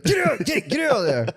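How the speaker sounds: high, urgent shoo at the dog; playful mock scolding of the dog; shooing yell. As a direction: chase the dog off the diaper bin.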